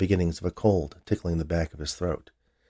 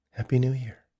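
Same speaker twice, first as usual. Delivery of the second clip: a light hint of Emily, pitched higher; Emily's voice; 6 semitones higher than usual. After the kiss Emily greets Roger, lightly voiced higher. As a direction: lightly voice Emily wishing him a happy new year. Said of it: "Then he heard Emily say".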